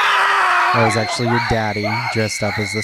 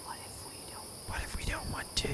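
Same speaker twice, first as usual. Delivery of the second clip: whisper; 20 dB quieter than usual. Acted. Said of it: "We sat off camera and just said".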